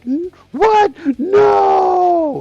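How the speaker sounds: high, loud shocked shout. Retold or imitated. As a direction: yell it like a kid caught off guard. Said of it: "Like that".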